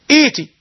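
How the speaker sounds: إيتي with a long ee in place of the second hamza; hamza turned long vowel. Shown as a demonstration. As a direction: stretch the first vowel of إيتي. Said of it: "سهلناها بالإبدال".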